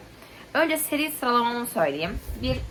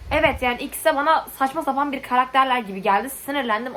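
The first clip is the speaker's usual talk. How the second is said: louder, higher, fed-up complaint; irritated; 5 semitones higher, 5 dB louder than usual. Real, not acted. Reviewer telling viewers both characters felt ridiculous, irritated.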